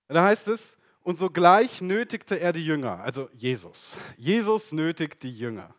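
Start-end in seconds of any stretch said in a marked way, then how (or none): 1.0-3.0 measured Bible reading voice; formal reading of the passage; scripture reading. Acted. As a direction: read the text with solemn weight. Reads scripture aloud.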